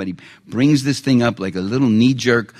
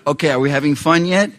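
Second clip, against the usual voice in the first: ordinary talk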